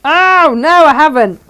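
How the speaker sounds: loud, high cry of dismay; real, high-pitched outburst of dismay; dismayed realisation. Real, not acted. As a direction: cry out on spotting your own mistake. Discovers she sewed it wrong.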